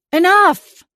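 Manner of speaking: stopping the two bickering prisoners, shouting. A narrator's reading. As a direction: cut them off with a yell. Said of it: "I shouted".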